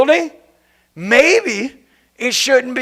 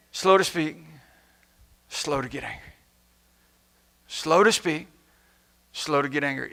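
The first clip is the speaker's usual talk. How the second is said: much softer and lower, slowing down; calm hush; 8 semitones lower, 11 dB quieter than usual. Acted. Preacher answers the revenge rant, soft and hushed. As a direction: settle the anger with a hushed reminder.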